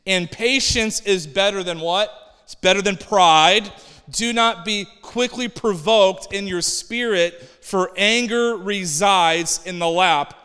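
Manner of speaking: loud, deliberate proclaiming; scripture reading. Real, not acted. Preacher reading Ecclesiastes to the congregation, loud deliberate proclamation. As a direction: declaim the verse with force, slowing to the end.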